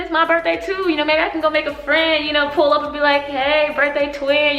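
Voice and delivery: acting out giddy hope of a new friend; excited. Hearing Happy Birthday sung, looking around, excited. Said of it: "excited to see who birthday it is".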